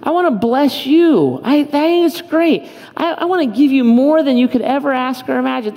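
lifted, earnest voice of God speaking; voicing God. Pastor voicing God to his congregation, earnest and lifted. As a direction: speak as God, tender but pleading. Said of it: "God says".

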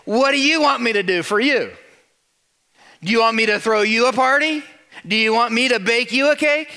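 mock the idea of a party with snark; snarky. Friend mocking Agnes's birthday, snarky and loud. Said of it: "with some snark in her voice".

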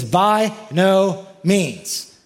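preacher voicing Paul's firm refusal; emphatic. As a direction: hammer each word down. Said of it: "he could not be more emphatic".

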